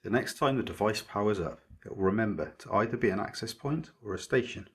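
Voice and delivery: ordinary talk, nothing marked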